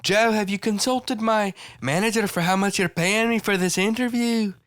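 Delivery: high-pitched bad Scouse accent; imitating Paddy the Baddy. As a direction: squeal out a mock Scouse accent. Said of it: "how to do a Scouse accent".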